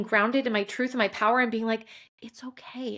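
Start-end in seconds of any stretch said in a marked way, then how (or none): 2.2-3.0 whispered, hushed comfort; soft self-reassurance. Retold or imitated. Woman giving herself permission, softly reassuring. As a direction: drop to a near whisper to soothe yourself. Soothing herself in a retold moment.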